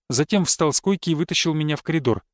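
ordinary delivery, nothing marked

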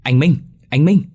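high, loud urgent call; little brothers calling out to Minh. A narrator's reading. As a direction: shout his name across the yard. Brothers calling him home.

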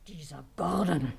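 a sharp, unvoiced whisper blurted out; sudden hissed outburst. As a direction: spit his name out in a whisper. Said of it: "platzte sie heraus". (plötzlich herausgeflüstert)

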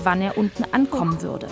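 ordinary delivery

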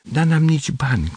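plain reading voice

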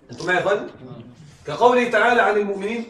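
ordinary talk, nothing marked

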